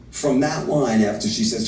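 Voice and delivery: ordinary talk, nothing marked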